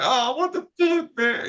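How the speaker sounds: high-pitched whiny-player imitation; mock protest. Players learn the girls left, mock protest. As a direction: imitate disappointed guys complaining.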